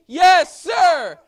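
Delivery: high, loud soldier's shout; shouted yes sir. Shown as a demonstration. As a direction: bark it out like a drill recruit. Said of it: "loud and proud".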